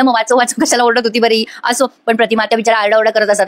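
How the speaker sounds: ordinary talk, nothing marked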